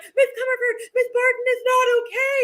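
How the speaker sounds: high, worried plea; alarmed kids. Retold or imitated. Students warning the teacher next door, high and alarmed.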